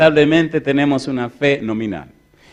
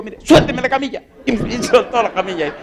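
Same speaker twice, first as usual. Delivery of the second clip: laughing; 9 semitones higher than usual. Real, not acted. Laughing at the stretcher tug.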